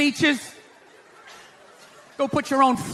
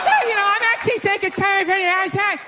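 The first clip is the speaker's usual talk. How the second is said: high, breezy butting-in voice; chatty wife; 6 semitones higher than usual. Acted. Wife butts in on the plumber, high and breezy. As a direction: cut in brightly with an unasked opinion.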